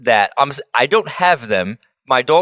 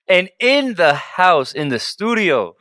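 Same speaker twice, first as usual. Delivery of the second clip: slow announcer-style build-up; drawn-out introduction; 4 semitones higher, slower than usual. Pretend. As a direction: stretch each phrase out to introduce the guest. Host introduces the guest.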